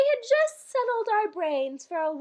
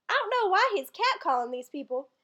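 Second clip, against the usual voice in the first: ordinary talk, nothing marked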